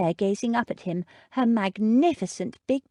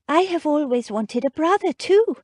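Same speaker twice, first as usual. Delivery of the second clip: shy; 9 semitones higher, 5 dB louder than usual. A narrator's reading. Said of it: "Winnie said shyly".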